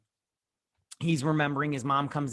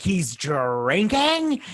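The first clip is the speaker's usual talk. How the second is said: loud, very drawn-out, high accusation; exaggerated outraged wail; mock accusing cry; 6 semitones higher, 6 dB louder, much slower than usual. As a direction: tattle at full volume, stretching every word. Mimics accusing tattletale.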